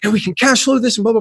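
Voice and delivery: words tumbling out with big excitement; excited rush. Retold or imitated. Founder pitching the affiliate idea to his partner, excited fast rush. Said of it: "I'm getting excited".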